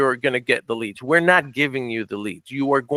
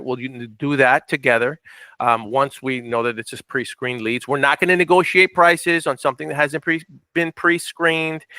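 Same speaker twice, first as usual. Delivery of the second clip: usual speaking voice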